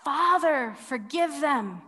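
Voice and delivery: calling out to the Father; a pleading cry upward; a voice lifted in a plea; cried out. Acted. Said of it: "Jesus cries out".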